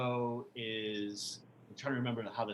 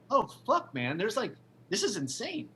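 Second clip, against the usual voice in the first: mock shock; 8 semitones higher than usual. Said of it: "I'm like".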